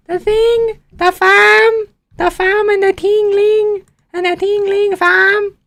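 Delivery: very high, slow mock Toronto accent. Shown as a demonstration. Streamer obliging a viewer's request with a silly Toronto accent. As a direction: exaggerate a sing-song Toronto slang accent. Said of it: "Give us a Toronto accent".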